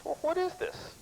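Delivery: high, puzzled cop question; suspicious cop. Retold or imitated.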